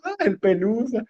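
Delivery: a short hammed-up teary sigh for the pet; crying over the cat. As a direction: son and mother mourning together, lightly faked weeping. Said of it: "llora con mamá".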